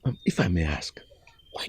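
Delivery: a whispered, wary question; hushed whisper. Acted. Servant whispering a cautious question to the prince, hushed. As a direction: whisper it so no one else hears.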